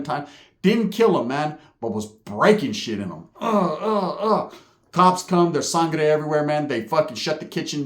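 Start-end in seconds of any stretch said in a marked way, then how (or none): 3.4-4.5 imitated victim groans.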